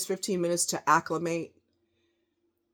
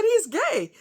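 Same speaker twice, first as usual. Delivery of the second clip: exasperated retort; 13 semitones higher, 4 dB louder than usual. Retold or imitated. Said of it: "I said".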